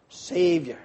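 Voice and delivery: ordinary talk, nothing marked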